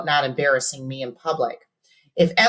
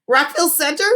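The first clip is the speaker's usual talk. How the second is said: yip; 12 semitones higher, 3 dB louder, faster than usual. A narrator's reading. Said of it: "I yipped".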